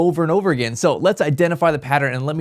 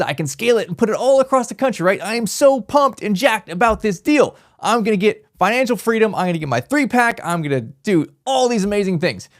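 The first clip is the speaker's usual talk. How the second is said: higher, hyped-up voice of a beginner; pumped newbie investor; 5 semitones higher than usual. Retold or imitated. Imitating an overexcited new investor dreaming of scaling. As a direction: gush like someone sure it's easy money. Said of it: "They're like".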